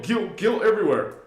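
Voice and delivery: usual speaking voice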